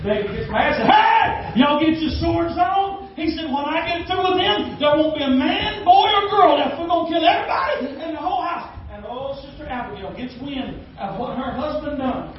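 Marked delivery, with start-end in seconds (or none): 0.9-3.1 rally the men with a mock battle cry; mock angry command. David calling his men to arms, mock angry. 3.5-8.8 mock angry threat. David vows revenge.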